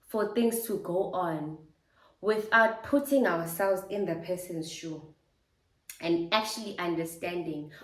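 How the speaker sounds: usual speaking voice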